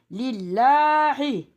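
"Lillahi" chanted slowly with a light, front "llaa"; thin lam recitation. Shown as a demonstration. Teacher showing the esre rule, "Lillahi" with thin lam. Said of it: "İnce".

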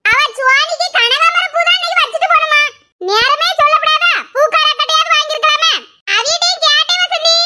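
high, carrying village voice; calling to a neighbour. Acted. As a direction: talk high-pitched, as if across to a neighbour. Neighbours talking, calling across.

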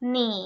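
หนี as one long, careful syllable; model pronunciation. Shown as a demonstration. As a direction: sound out หนี slowly for learners to copy. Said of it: "ออกเสียงว่า".

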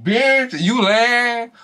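high laughing disbelief; retold reaction. Vlogger replaying her shocked reaction to the reunion show. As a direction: squeal it like you can't believe it. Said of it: "I said".

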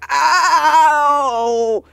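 loud drawn-out "ow"; long pained howl. Acted. Dwarf on his side after being kneed, pained howl. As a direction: wail in exaggerated pain.

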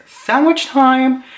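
high, loud, drawn-out exclamation; mock excited squeal. Caught dancing between two guys, high gleeful mock squeal. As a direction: sing it out high and slow.

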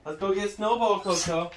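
ordinary talk

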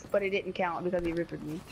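normal talking voice

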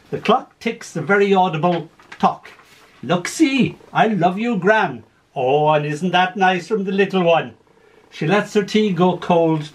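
lively story poem read aloud. Acted. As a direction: tell it with gentle humour. Reads a poem with voiced figures.

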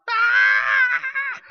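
shrill high-pitched shriek, loud; high girly scream. Retold or imitated. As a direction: let out a little-girl scream. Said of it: "I screamed like a little girl".

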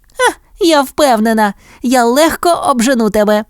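high-pitched, boastful puppy voice; confident cry. A narrator's reading. Puppy Penny challenging her brother, high confident cry. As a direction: brag eagerly in a high little voice. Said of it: "крикнула Пенні".

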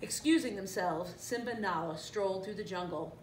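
ordinary delivery, nothing marked

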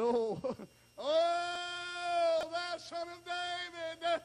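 long, high wailing plea; beggar's drawn-out holler; crying out. Acted. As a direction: cry out for mercy on stretched high notes. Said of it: "he cried out".

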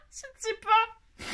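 high, slow words through a laugh; can barely get it out for laughing; a real fit of giggles. Real, not acted. Cracking up over the doll.

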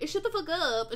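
high, mock shout without much volume; read-aloud shout. A narrator's reading. Cornelio silencing Draco in the story, mock shouting. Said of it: "shouted Cornelia".

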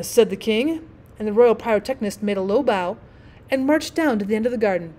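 usual speaking voice

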